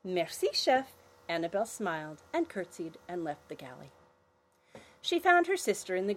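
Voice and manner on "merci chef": put-on higher polite girl; sweet little thank you.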